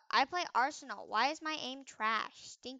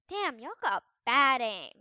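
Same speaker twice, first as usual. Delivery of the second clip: ordinary talk, nothing marked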